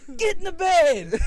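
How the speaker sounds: high, loud shouted order; hammed-up yell at wit's end; exasperated shout. Husband ordering naked wife to bed, exasperated shout.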